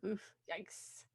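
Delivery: soft, breathy oof, yikes; barely audible yikes; hushed wince. Real, not acted. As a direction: wince it under your breath. Wincing reaction.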